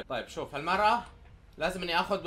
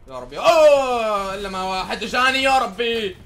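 loud, drawn-out slowly; real annoyance stretched out; long frustrated plea to God; exasperated groan; 3 semitones higher, 10 dB louder, slower than usual. Real, not acted. Nobody comes to him.

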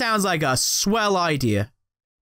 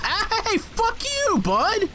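much higher pitched, exasperated "fuck you, bud"; angry yell at bug; 13 semitones higher, much slower than usual. Real, not acted. Player yells at a pesky bug, high exasperated outburst.

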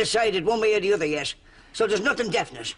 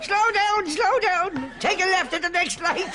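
mock wheelbarrow driver; 12 semitones higher, slower than usual. Mimes wheelbarrow ride, giving directions.

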